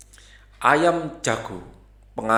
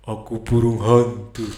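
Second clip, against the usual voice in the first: lightly voiced owl; a hint of owl voice introducing itself; owl answering; 2 semitones lower than usual. Owl introduces itself to the rooster, lightly voiced.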